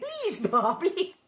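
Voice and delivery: put-on high, loud child's plea; begging. A narrator's reading. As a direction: beg Pa urgently, voice lifted. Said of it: "Laura begged".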